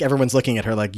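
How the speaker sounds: normal talking voice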